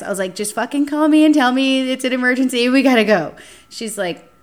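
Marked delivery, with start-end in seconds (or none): 0.3-3.3 louder, higher urgent begging; begging her sister on the phone, loud and urgent; urgent plea. Retold or imitated. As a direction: press her to call now.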